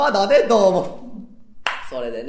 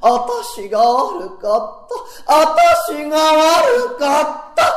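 tearful apology; 9 semitones higher, 4 dB louder, much slower than usual. Acted. Wife apologizing, clinging.

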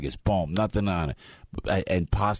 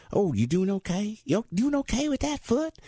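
much higher, sugary southern driver; sweet driver voice; 14 semitones higher than usual. Retold or imitated. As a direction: play the overly kind driver, pitched way up. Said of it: "the driver says to the lady".

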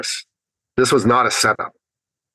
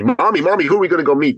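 put-on higher, eager child voice; excited little boy; 6 semitones higher, faster than usual. Little son asking mommy whom they will meet, excited. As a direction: imitate a little boy pestering his mommy. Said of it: "They get all excited about things".

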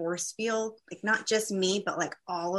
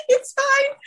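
much higher, strained through tears; tearful squeak; 9 dB louder than usual. Retold or imitated. Reassuring strangers at communion through tears, high and squeaky. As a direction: squeak it out while sobbing. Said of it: "I'm just crying hysterically".

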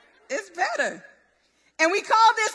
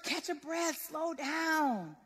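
usual speaking voice

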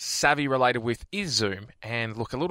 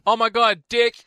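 higher, louder mocking oh my God, Dick; mock excited; 9 semitones higher, 6 dB louder than usual. Co-host mocking her gaffe, higher and louder. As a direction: parrot her gaffe with glee. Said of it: "co-host mimicking her blunder".

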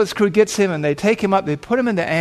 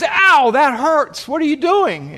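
high, drawn-out "ow" of protest; acted yelp of pain and complaint; pained outcry; 9 semitones higher, slower than usual. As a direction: cry out in pain at the one treating you. Injured biker reacts to tourniquet.

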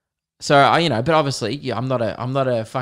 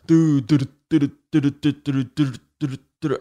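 imitating the beat drop. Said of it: "It was like".

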